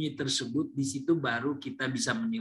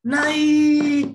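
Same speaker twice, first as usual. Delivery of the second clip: NAIK very high and loud, held long; gleeful shout; 12 semitones higher, 9 dB louder than usual. Shown as a demonstration. Lecturer acts out someone thrilled at a score going up, gleeful long shout. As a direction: celebrate with one long jubilant NAIK. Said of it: "terus dia tunjukkan dengan kegirangan".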